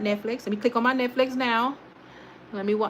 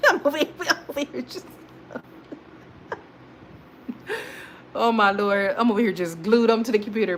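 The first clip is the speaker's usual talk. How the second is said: laughing; faster than usual. Real, not acted. Laughing at herself.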